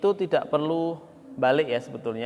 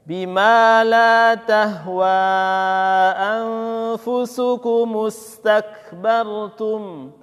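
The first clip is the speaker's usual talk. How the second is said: very slow chanted verse, louder; slow recitation; 6 semitones higher, 7 dB louder, much slower than usual. Shown as a demonstration. Teacher chants the joined verse for students to repeat. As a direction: draw out each word in melody.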